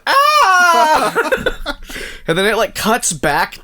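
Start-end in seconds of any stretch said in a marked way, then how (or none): 0.1-1.8 high, loud laughing "Ah!"; hammed-up pained scream; mock scream. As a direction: scream as if shot again, cracking up. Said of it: "then he goes".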